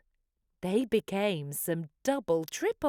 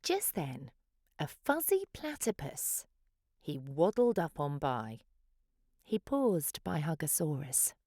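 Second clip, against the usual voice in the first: plain reading voice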